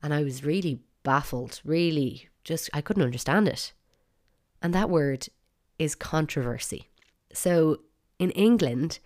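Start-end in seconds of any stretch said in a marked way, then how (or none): none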